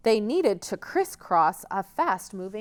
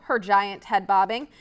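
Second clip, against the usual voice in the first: ordinary delivery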